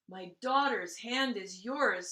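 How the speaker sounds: plain reading voice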